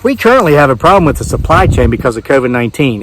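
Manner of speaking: normal talking voice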